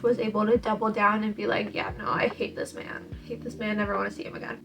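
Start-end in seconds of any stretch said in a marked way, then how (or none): 1.7-4.6 hushed scorn. Retold or imitated. Elizabeth scornfully rejecting Darcy.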